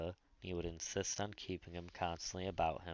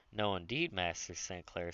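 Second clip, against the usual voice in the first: usual speaking voice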